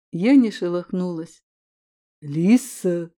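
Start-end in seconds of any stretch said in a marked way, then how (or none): 2.2-3.1 demanding. A narrator's reading. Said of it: "произнёс мужчина требовательнее".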